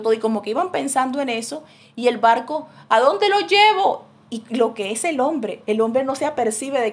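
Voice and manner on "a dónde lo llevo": higher, louder, slow call of the boatman; boatman asking. Acted. Boatman asking the missionaries where to go, raised slow call. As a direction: ask it out loud and slowly, like a sailor at the helm.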